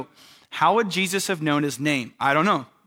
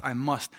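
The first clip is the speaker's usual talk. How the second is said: low, soft, firm landing on "must"; emphatic MUST; 4 semitones lower, 7 dB quieter than usual. Retold or imitated. Preacher driving the point home, low and firm on "must". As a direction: repeat it quietly, weight on "must". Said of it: "a point that is driven home".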